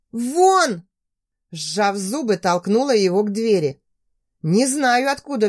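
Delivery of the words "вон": shouting. Acted. Heroine shouts him out.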